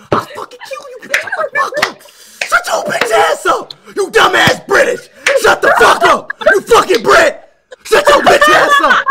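shrieked abuse, building in volume; high-pitched screaming rant. Real, not acted. Streamer drowns out caller with insults, high screaming rant. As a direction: scream insults high over the other side.

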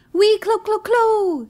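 very high loud clucking call; bird call. Acted. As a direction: call out like a guinea fowl. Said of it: "she called out to Cow".

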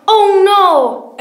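high, very loud "caught out" wail; alarmed cry. Acted. Santa is caught by the bears, alarmed cry. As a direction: belt it out in storybook dismay.

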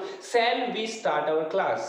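drawn-out question to the class; slow teacher question. Shown as a demonstration. Teacher asks the class a question, very slowly. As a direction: ask it slowly so they follow. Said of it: "चलो दोबारा पूछता हूँ".

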